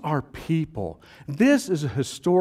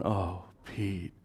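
softer, lower, slow groan; muttered groan; 6 semitones lower, 10 dB quieter, much slower than usual. Retold or imitated. Disciples dreading Peter's boast, soft and low. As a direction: groan it under your breath. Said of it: "some guys in there going".

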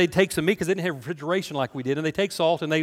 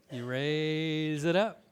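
drawn out; 5 dB quieter, much slower than usual. Acted. Playfully draws out the flag answer.

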